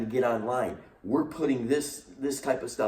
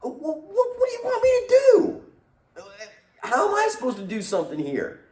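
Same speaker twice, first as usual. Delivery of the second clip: very high, loud panicked squeal; high frantic flustered Moses; exasperated Moses; 6 dB louder than usual. Retold or imitated. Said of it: "Moses must have been like".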